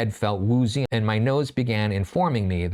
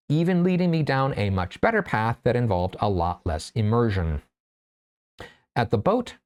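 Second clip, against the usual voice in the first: ordinary delivery, nothing marked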